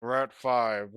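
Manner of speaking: slurred through stuffed cheeks; mouth full. Real, not acted. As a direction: talk around a mouthful of marshmallows. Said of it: "I'm stuffing one more in".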